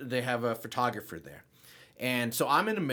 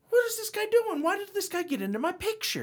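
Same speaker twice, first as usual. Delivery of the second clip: indignant. Retold or imitated. Indignant inner monologue retold.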